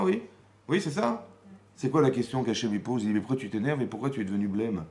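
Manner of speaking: ordinary talk, nothing marked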